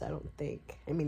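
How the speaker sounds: normal talking voice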